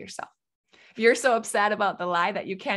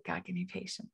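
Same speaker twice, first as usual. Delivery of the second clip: a much softer prayer muttered to herself; muttered under breath; 13 dB quieter than usual. Retold or imitated. Frustrated parent praying for patience, muttering under breath. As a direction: mutter it almost to yourself. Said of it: "I usually mutter under my breath".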